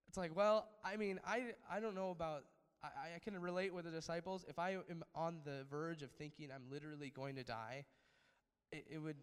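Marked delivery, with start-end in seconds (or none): none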